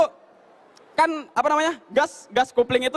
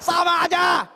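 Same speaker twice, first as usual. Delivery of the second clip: exasperated shout; 5 semitones higher, slower than usual. Real, not acted. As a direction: fling out the punchline. Punchline exasperation.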